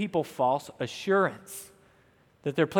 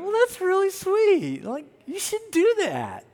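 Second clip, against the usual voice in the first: high-pitched mock swooning listener; put-on high, cooing approval; gushing onlooker; 11 semitones higher than usual. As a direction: imitate a charmed admirer, pitched way up. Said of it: "some of you might be thinking".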